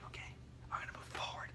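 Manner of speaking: unvoiced whisper to the team. Officer whispering to his team near the suspect, hushed whisper. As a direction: whisper so the suspect can't hear. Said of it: "I would be like".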